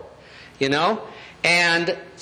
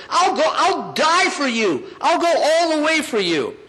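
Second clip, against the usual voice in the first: high, fervent acted pledge; hammed-up heroic vow; Peter; 9 semitones higher than usual. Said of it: "the other times he says".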